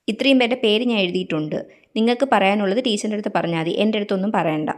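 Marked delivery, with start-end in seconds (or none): none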